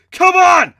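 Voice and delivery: very high, very loud shout; shouted call. Acted. Hero grabbing Tiger to retreat, loud shout. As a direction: yell to pull him away. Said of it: "I shouted, grabbing Tiger".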